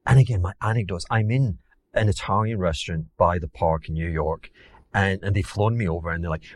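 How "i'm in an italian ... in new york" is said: ordinary talk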